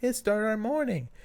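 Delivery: put-on high baby-talk coo. Dad retelling the cheerful morning cooing, high. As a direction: gush over the baby in a high voice.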